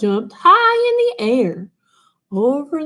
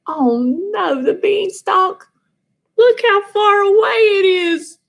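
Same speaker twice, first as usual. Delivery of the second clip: dismayed; 9 semitones higher, 3 dB louder than usual. Acted. Cat dismayed at distance.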